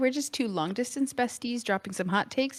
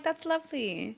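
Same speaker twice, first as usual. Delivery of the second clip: higher, softer sincere approval; 4 semitones higher, 5 dB quieter than usual. Shown as a demonstration. Co-host contrasting with her sarcastic lovely, sincere. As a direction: say it softly, meaning it. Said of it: "But then sometimes I'm like".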